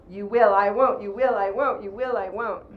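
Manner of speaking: loud back-and-forth standoff; power-struggle bickering. Acted. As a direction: trade stubborn jabs, loudly. Acting a parent-child power struggle.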